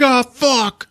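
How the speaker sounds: high-pitched mock panic; acted alarm. Rusty seeing Cindy grabbed from behind, acted alarmed cry. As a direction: cry out in sudden dismay.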